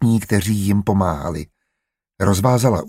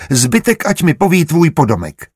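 higher, insistent interrogator; interrupting order; 7 semitones higher, 4 dB louder than usual. A narrator's reading. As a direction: cut in with a demand. Jesuit interrupts, orders servant to answer.

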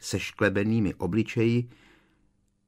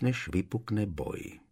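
plain reading voice

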